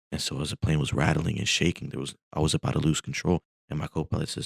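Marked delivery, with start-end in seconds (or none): none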